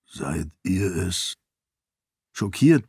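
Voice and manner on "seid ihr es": low, hesitant voice from the dark; deep unsure whisper. A narrator's reading. The seon Ashe finds Sarene in the dark, deep and hesitant. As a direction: ask it low and uncertain, almost afraid to hope. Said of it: "flüsterte eine tiefe, unsichere Stimme".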